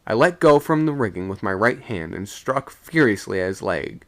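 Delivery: plain reading voice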